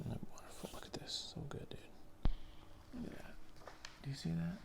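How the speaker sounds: hushed, breathless whisper; awed whisper. Real, not acted. Vlogger marvelling at a beautiful panel, in a whisper. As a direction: whisper it like you're afraid to break the spell. Admiring the drawing up close.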